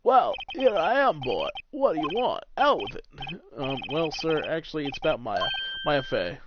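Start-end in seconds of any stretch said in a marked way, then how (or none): 0.0-3.1 grand, blustering attorney voice; theatrical veteran lawyer, impatient; pompous older lawyer. Acted. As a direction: boom it out self-importantly.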